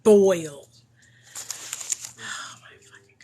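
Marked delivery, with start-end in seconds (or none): 2.2-3.2 exasperated whisper under breath. Real, not acted. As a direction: breathe it out in disbelief. Exasperated after reading CPS lies.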